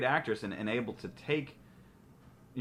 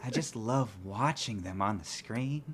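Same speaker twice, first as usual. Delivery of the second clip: put-on creepy old guy voice; 4 semitones higher, 4 dB louder than usual. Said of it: "in his creepy old guy voice".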